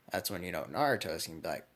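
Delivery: ordinary talk, nothing marked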